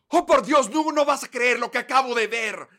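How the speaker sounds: high, loud over-the-top gasp; clickbait video narrator. Retold or imitated. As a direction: fake amazement for the camera. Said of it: "línea que tienen que decir es".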